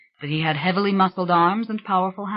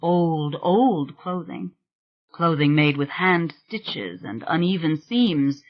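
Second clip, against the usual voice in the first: ordinary delivery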